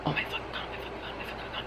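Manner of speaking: frantic whispered chant under the breath; panicked whisper. Retold or imitated. Seeing the window while falling, panicked whisper. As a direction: whisper it over and over in mock panic.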